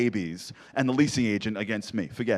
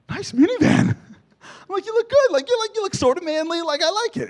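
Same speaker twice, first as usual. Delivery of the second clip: much higher, louder put-on teasing voice; mock flirty taunt; 8 dB louder than usual. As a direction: squeal it up high like teasing a rival. Taunting other minivan driver.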